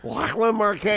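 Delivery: high "Lachlan Marquet" with a throaty Scottish ch; Scottish pronunciation. Shown as a demonstration. Host showing his Scottish accent on "Lachlan Marquet", throaty ch. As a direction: say "Lachlan" with a rasped ch in the middle, Scottish style. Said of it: "how you pronounce Lachlan Marquet".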